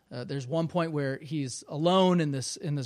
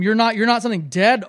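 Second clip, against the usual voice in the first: loud, higher, hurried alarm in a read-aloud; almost screaming; 6 semitones higher, 11 dB louder, faster than usual. A narrator's reading. Frightened boy questions the unseen thing, almost screaming. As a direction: blurt it out, raised and rushed. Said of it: "almost in a scream".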